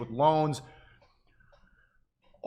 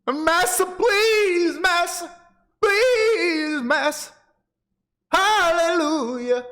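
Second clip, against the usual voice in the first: high, loud, hammed-up pleading cry of "Massa"; over-the-top mock servant wail; 7 dB louder, much slower than usual. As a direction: ham up a begging servant, much higher and louder.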